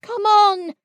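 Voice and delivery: high, loud echoed call. A narrator's reading. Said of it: "called Pooh and Piglet".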